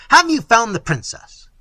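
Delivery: loud, high-pitched question; urgent demand. Acted. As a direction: demand news from the searchers. Prince calls to approaching servants.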